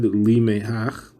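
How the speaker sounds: "Limehach" said slowly, Li-meh-ach; "Limehach" drawn out, syllable by syllable; correct reading. Shown as a demonstration. Teacher models the correct "Limehach", slowly. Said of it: "should not be spelled with a yud".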